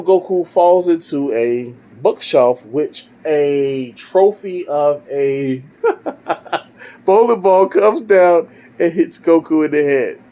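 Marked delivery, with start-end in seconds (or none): 5.8-6.6 high chuckle; laugh. Real, not acted. Storyteller amused by the falling trophy, chuckling. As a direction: laugh at your own story.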